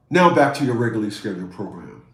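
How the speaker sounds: deep mock broadcast announcer. Announcer-style sign-off.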